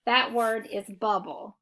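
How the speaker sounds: ordinary talk, nothing marked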